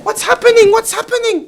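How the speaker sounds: very high, loud alarmed voice; shocked receptionist. Retold or imitated. Hotel receptionist seeing the bloody guest, shocked. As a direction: gasp in horror at the blood.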